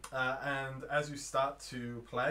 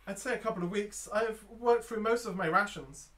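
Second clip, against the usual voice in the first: raised, high voice of a trapped woman; female voice; 7 semitones higher, 4 dB louder than usual. Acted. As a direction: answer as a stranded female traveller. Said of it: "It's a female voice".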